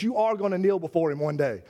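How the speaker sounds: ordinary talk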